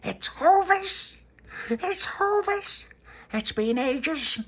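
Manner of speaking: very high, loud and drawn-out; stretched, high-pitched childish excitement; excited announcement. Acted. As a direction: squeal it slowly with huge delight. Tony shows off bread to toddler.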